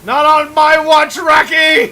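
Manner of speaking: loud heroic yell; heroic shout. Real, not acted. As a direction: shout it like a hero.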